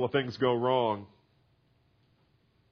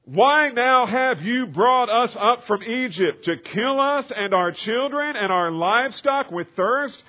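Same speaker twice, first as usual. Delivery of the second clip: high, loud, slow complaining crowd; complaining Israelites; 11 semitones higher, 9 dB louder than usual. Acted. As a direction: cry out the grievance like thirsty people. People complaining to Moses, read aloud.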